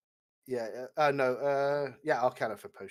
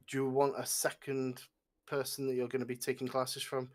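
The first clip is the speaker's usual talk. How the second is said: normal talking voice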